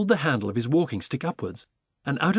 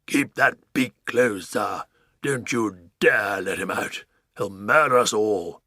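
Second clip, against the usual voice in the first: Duke bellowing; 2 semitones lower, 4 dB louder, slower than usual. Acted. Duke pointing his sword at the pelican, slow bellow. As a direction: order it with slow gravity. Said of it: "he bellowed".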